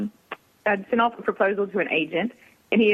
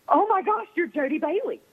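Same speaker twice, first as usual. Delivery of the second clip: high-pitched gush of recognition; squealed, starstruck greeting; gushing fan; 9 semitones higher than usual. Retold or imitated. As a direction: imitate an excited fan spotting their idol. Retold fan rushing up to her.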